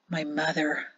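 small, low-key groan of realisation; muted dread. A narrator's reading. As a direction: say it under your breath, dreading it. Husband dreads his mother's reaction.

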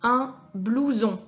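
Un blouson read slowly and clearly; slow, careful reading of Un blouson; slow model word. Shown as a demonstration. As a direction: lay out the word for the child to test. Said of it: "tu entends S ou si tu entends Z".

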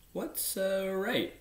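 high, slow, wondering question; lightly voiced little prince, puzzled. A narrator's reading. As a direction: ask it slowly in a lifted, curious voice. Prince asks about an unknown word.

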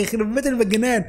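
ordinary talk, nothing marked

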